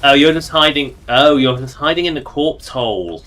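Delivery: usual speaking voice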